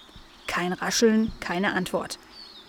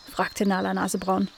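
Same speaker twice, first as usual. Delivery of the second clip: ordinary delivery, nothing marked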